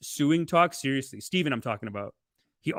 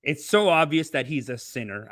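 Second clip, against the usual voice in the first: ordinary talk, nothing marked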